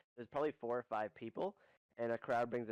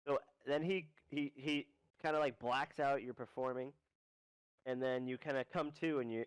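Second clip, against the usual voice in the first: ordinary talk, nothing marked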